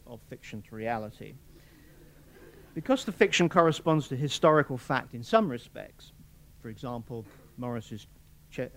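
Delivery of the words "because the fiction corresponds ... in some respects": ordinary delivery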